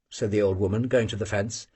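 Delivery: usual speaking voice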